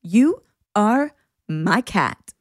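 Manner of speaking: slow, drawn-out sleep-talk; imitated sleep-talker. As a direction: spell it out slowly, like someone talking asleep. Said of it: "points random places and goes".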